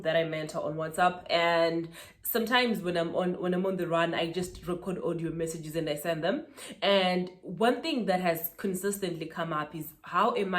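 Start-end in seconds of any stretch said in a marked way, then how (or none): none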